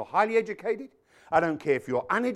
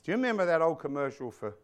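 normal talking voice

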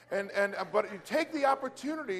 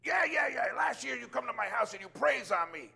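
excited customer; 5 semitones higher than usual. Retold or imitated. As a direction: gush like a man who got his miracle. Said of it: "He goes".

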